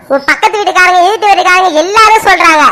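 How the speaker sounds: ordinary delivery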